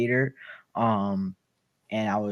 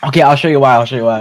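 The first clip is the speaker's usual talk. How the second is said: loud excited; 2 semitones higher, 14 dB louder, faster than usual. Real, not acted. Excited to show soundboard.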